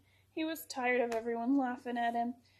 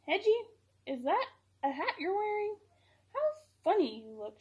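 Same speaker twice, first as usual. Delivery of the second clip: barking dog; 7 semitones higher, 3 dB louder than usual. A narrator's reading. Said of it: "she barked".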